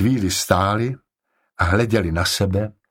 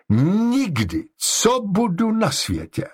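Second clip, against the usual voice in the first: high-pitched, emphatic vow; rozhořčený slib; 12 semitones higher, slower than usual. A narrator's reading. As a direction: swear it in high-pitched indignation. Upset wife vows never again. (vysoko, rozhořčeně a důrazně)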